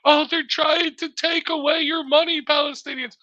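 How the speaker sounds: high, slow, whiny mock plea; mocking the wife. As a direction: ham up the widow pleading on TV, pitched high and drawn out.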